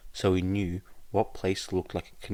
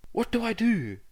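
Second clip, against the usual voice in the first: high, loud, panicky question; frightened; 6 dB louder than usual. Acted. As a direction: blurt it out in fear. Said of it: "in a hurried and frightened voice".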